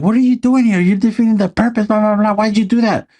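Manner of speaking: very high, squeaky imitation of a scolding boss; hammed-up high-pitched scolding; mock scolding officer. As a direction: squeak it like a cartoon of the angry commander. Quoting movie dialogue, very high.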